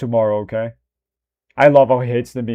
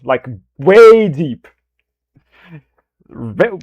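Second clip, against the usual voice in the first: usual speaking voice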